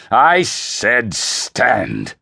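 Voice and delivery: slow, loud commanding roar; thundering. Acted. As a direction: thunder an order, drawing out each word. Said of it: "the Emperor thundered".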